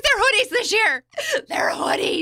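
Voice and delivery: high, loud, gushing over the hoodies, breaking into a laugh; showing her husband the hoodie bottles, excited and laughing; excited squeal. Retold or imitated. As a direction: squeal it like you can't believe how cute it is. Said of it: "I'm like".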